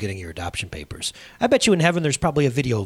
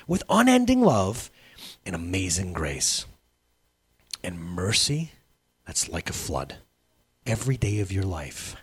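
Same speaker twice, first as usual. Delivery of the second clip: tender; 6 semitones lower, 4 dB quieter than usual. Real, not acted. Preacher softens to tender close.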